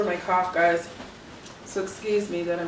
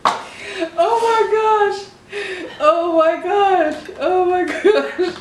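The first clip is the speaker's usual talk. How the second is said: high, drawn-out amazed gasp; slow, laughing disbelief; 9 semitones higher, 5 dB louder, much slower than usual. Real, not acted. Amazed at tongue twister.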